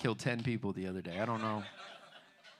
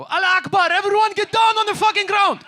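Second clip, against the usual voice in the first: full-force high-pitched yell; terrorizing bellow, far higher and louder; menacing shout; 20 dB louder than usual. Acted. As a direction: scream a command at a crowded room. Acts menacing outburst in restaurant.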